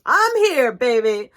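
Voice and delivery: high, loud sassy announcement; the sun swaggers in; playful diva arrival. Acted.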